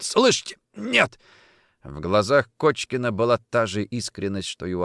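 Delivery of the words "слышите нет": high, strained denial; choked insistence. A narrator's reading. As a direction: force it out while being strangled. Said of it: "хрипел тот".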